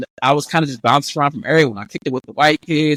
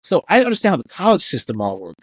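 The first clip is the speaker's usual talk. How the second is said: ordinary talk, nothing marked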